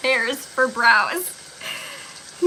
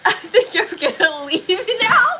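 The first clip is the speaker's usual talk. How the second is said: high, louder, laughing through the words; giggling, barely able to get it out; 5 semitones higher, 4 dB louder than usual. Real, not acted. Streamer to chat, laughing as she threatens to leave. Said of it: "I just start laughing at everything".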